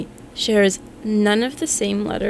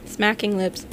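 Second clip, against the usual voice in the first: ordinary delivery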